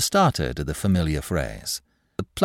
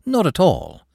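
high, quick disclaimer; deprecating; 12 semitones higher, 4 dB louder than usual. A narrator's reading. Clerk denies using the manager's visit, deprecating. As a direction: wave it away politely in a raised pitch. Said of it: "said Smith deprecatingly".

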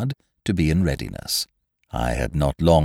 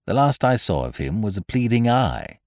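ordinary delivery

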